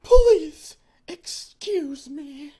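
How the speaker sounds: very high, very slow fake distress; hammed-up sob voice. [name] excuses herself to the employee, high hammed-up distress. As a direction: squeal it out like a badly faked sob.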